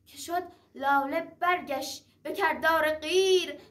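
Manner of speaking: ordinary delivery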